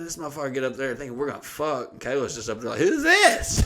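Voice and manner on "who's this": high-pitched mock surprise with a laugh; startled question. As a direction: imitate a roommate squealing at a stranger. Kayla startled by the stranger.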